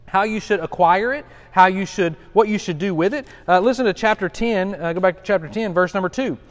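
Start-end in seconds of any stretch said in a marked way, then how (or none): none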